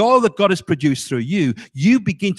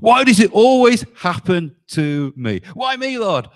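mock complaining; 3 semitones higher, 4 dB louder than usual. Preacher imitating believers who complain to God, mock moaning. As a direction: act out a self-pitying moan to heaven. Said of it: "when we start complaining and moaning".

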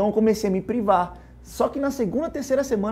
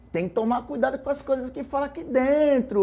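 higher-pitched mock warning; raised, cautioning quote; retold warning; 6 semitones higher than usual. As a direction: imitate someone cautioning, pitched up. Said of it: "ela fala".

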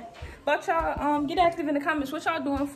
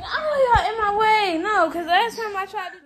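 loud whiny cry; 9 semitones higher, 10 dB louder than usual. Real, not acted. Crying out amid chaos.

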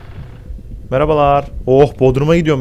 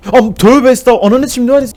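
much higher, louder scared cry; frightened outburst; 11 semitones higher, 9 dB louder than usual. Real, not acted. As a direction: blurt in fright. Jumpscare reaction.

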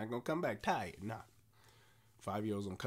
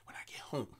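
whispered, drained and resigned; weary whisper; 5 semitones lower, 8 dB quieter than usual. Retold or imitated. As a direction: whisper it like a worn-out parent. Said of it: "'cause you like".

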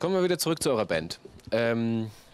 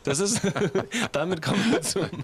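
lachend; 7 semitones higher than usual. Real, not acted. Interviewer lacht über Versprecher.

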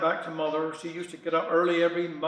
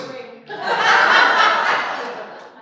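high-pitched burst of laughter; 12 semitones higher, 6 dB louder than usual. Real, not acted. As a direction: laugh at the punchline. Laughs at own punchline.

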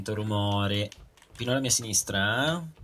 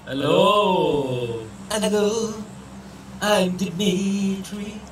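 high, drawn-out character voice; put-on Dimitri; 8 semitones higher, 3 dB louder, much slower than usual. Introducing himself to teammates as Dimitri, put-on character voice. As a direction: stretch out a high, silly self-introduction.

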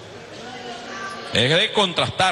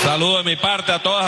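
normal talking voice

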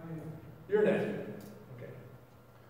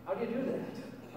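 ordinary talk

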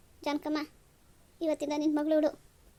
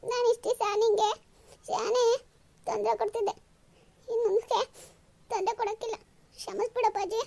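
higher, tearful voice, close to tears; tearful farewell; 6 semitones higher than usual. Acted. Daughter leaving home, apologising to father. (ಕಣ್ಣೀರು ತಡೆಯುತ್ತಾ ಮಾತು)

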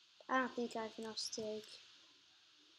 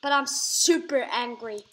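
mock angry; 11 dB louder than usual. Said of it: "I'm very angry right now".

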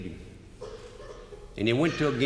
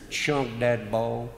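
normal talking voice

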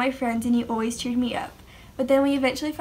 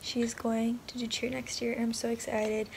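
whispering; 3 semitones lower, 9 dB quieter than usual. Real, not acted. Whispering at bedtime.

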